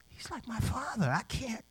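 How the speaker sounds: soft, high voice imitating a girl; girl's inner thought. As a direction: murmur it as her private realization.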